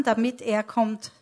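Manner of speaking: normal talking voice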